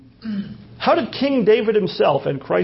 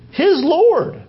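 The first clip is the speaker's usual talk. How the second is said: high, loud exclamation of his Lord; a high, loud proclaiming his Lord; emphatic answer; 11 semitones higher, 4 dB louder than usual. Acted. Preacher answers his own question.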